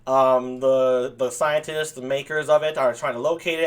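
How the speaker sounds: ordinary talk, nothing marked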